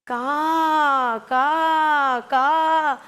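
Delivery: three separate drawn-out caws; crow caw. Shown as a demonstration. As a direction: imitate a crow for children, pausing between calls. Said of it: "ഒന്നുകൂടി teacher പറഞ്ഞു തരട്ടെ".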